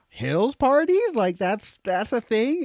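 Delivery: high-pitched retold disbelief; incredulous. Newcomer recalls his reaction to buddy's party tip, incredulous. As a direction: question it in a high, astonished voice.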